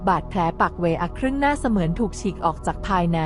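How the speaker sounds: plain reading voice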